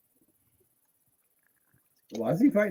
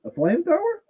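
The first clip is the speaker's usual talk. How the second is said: stunned disbelief; faster than usual. Real, not acted. Shocked at flamethrower news.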